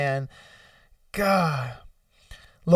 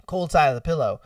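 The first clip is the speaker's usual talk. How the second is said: ordinary talk, nothing marked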